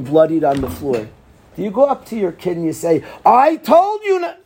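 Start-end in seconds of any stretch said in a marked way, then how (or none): 3.2-4.4 high, loud I-told-you-so; mock scolding parent. Parent scolds bloodied son, high and sharp.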